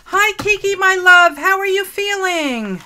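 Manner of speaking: high, very loud excited hello; startlingly loud greeting. Real, not acted. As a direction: belt out a big hello to a friend.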